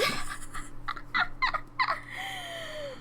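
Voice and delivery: long, soft, very high giggle; high laugh. Real, not acted. Streamer amused by the absurd story, high soft laughter.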